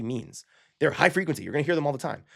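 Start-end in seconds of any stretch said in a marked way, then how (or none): none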